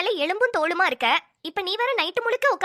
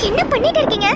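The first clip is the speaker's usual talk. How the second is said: higher-pitched, alarmed challenge; shocked demand; 5 semitones higher, 4 dB louder than usual. Acted. Catching her with the syringe, shocked demand.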